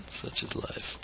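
soft whispered aside. Real, not acted. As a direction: whisper it to yourself. Whispered repeat of same words.

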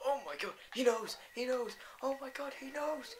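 high loud panicked cries. Real, not acted. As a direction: shriek in alarm as it comes for you. Mob spots him.

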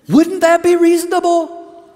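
high, loud, ringing question; preacher pushing the crowd to answer; rousing challenge. Real, not acted. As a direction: call it out to the whole room.

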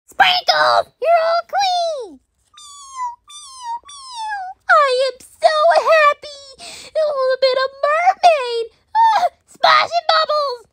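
high, gleeful cartoon voice; high-pitched playful delight; happy toy puppy. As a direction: rejoice as a thrilled little puppy.

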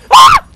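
piercingly high, loud shriek; startled scream. Real, not acted. Player hit by a jump scare, shrieking. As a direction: scream in sudden fright.

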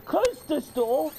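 high, loud cries of alarm; frightened shout. Real, not acted. Player desperate to close the door, frightened shout. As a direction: shout in real panic.

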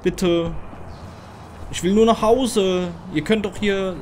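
high, drawn-out plea; whiny pleading. Real, not acted. Player begging the aliens to let them home, whiny pleading. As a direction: beg pitifully, stretching the words.

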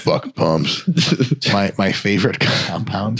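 lightly voiced gym bro. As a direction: toss it off like a meathead. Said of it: "I wanna answer in the bro voice".

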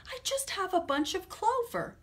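high little rabbit voice. Acted. The rabbit offering the rat its clover, high and small. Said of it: "the rabbit replied".

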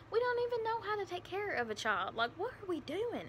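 high-pitched, overwhelmed inner voice; retold panic. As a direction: let the pitch leap as doubts pile up. Retells her own panicked thoughts.

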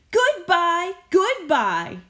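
high, loud sing-song goodbyes; cheery farewell. Acted. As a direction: wave them off gleefully. Busby waving back.

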